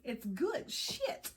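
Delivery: slow, lightly voiced movie quote with a laugh; Crocodile Dundee lady. Tarot reader quotes the Crocodile Dundee lady, lightly imitating. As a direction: drawl it out like the lady from the film, laughing. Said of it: "Like that lady from Crocodile Dundee".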